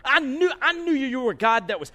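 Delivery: loud, high, indignant outburst; I knew it, said hotly at God; mad at God. Acted. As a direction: prophet venting at God about mercy, angry. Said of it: "He is mad".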